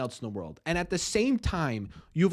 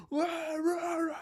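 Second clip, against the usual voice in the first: crowd roar; 14 semitones higher, 6 dB quieter than usual. Retold or imitated. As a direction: ham up a crowd of angry people going rah rah. Imitating angry town hall crowd.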